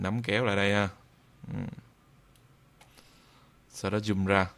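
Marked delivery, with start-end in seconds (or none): none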